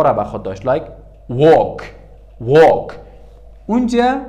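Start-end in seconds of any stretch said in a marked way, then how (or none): 1.3-2.9 "walk" said with no L sound, loudly; silent L. Shown as a demonstration. Teacher showing learners the silent-L rule, "walk" without L.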